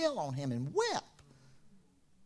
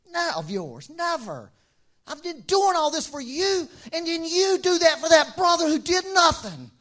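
rising higher and louder into outrage; building resentful complaint; 8 semitones higher, 6 dB louder than usual. Acted. Older brother complaining to father, rising resentment. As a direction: vent the grievance.

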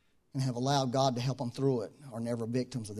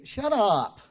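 blunt loving rebuke; 10 semitones higher, 9 dB louder than usual. Retold or imitated. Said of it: "tell you".

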